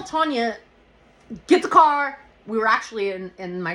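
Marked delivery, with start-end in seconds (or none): 1.5-2.2 raised, high urgent command; urgent order. Retold or imitated. Injured woman to her friend, urgent order. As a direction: call it out urgently, pitched up and loud.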